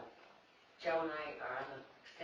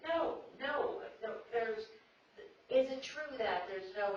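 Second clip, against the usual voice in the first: incredulous brother; 8 semitones higher, 6 dB louder, slower than usual. Retold or imitated. Brother disbelieving, repeating.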